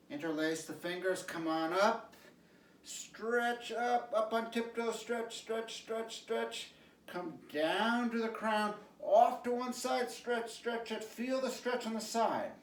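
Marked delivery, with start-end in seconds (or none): 2.8-12.5 high, bright coaching call; lifted instructor cue voice; energetic stretch cueing. Acted. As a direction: pump up the class as they stretch.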